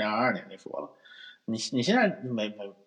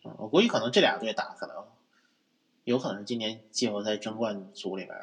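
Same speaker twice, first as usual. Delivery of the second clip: ordinary talk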